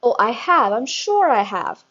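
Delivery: loud, gloating; grinning. A narrator's reading. Headmistress gloating at the teacher, loud. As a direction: say it with a grin.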